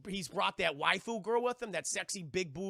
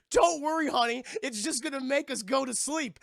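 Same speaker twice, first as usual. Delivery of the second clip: high, loud mock husband; hammed-up cheerful reassurance, pitched up; naive reassurance; 6 semitones higher, 7 dB louder than usual. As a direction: play a foolish husband soothing loudly. Ron reassures Hermione naively.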